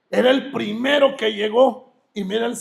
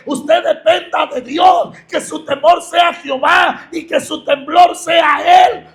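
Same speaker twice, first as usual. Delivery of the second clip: loud, high preaching at full force; impassioned pulpit sermon voice; fiery preaching; 6 semitones higher, 8 dB louder than usual. Acted. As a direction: preach it out, pounding the congregation.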